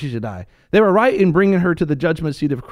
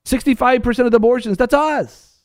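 fiery preaching; 7 semitones higher than usual. Acted. Preacher rebuking the church, in fiery high preaching. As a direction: hammer each point at the congregation.

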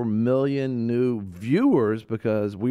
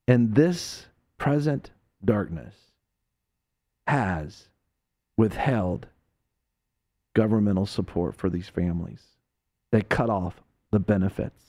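solemn and deliberate, dropping lower; grave, measured; 3 semitones lower than usual. Real, not acted. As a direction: let each phrase land on its own. Grave, halting indictment after outburst.

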